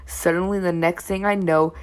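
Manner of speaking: usual speaking voice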